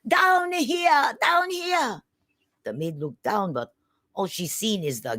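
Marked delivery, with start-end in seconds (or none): 0.1-2.0 high, loud tiny shout; yelling. Acted. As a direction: call up from the floor so the maid looks down. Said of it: "and he yelled".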